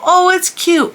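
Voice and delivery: put-on very high, sweet little-girl voice; four-year-old niece. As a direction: coo it high like a Disney princess. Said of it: "very high".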